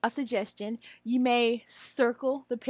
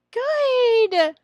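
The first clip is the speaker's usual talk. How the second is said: teacher praise; 12 semitones higher, 10 dB louder than usual. Shown as a demonstration.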